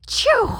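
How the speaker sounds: loud high acted sneeze; hammed-up sneeze ending a fake ah. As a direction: burst out with a big loud sneeze. Gerald sneezes again.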